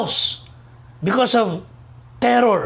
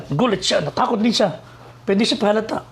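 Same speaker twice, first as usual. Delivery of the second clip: ordinary talk, nothing marked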